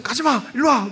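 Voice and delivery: put-on father calling his child away from mom; urgent call. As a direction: call the child back in a hurry. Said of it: "제가 있었으면".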